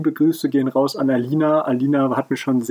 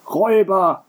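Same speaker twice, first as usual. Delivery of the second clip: higher, lightly acted groan; 6 semitones higher, 3 dB louder than usual. A narrator's reading. Innkeeper on stage lamenting robbers, groaning. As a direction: wail it in mock despair. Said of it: "stöhnte Jojo weiter".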